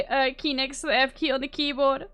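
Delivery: normal talking voice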